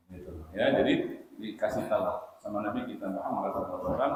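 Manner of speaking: normal talking voice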